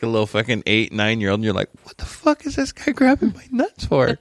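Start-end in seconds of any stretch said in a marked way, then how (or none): 1.8-4.2 put-on high, indignant kid's outburst; baffled kid. An eight-year-old boy asking why the doctor grabs him, high and baffled. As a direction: squeak it out like a shocked little boy.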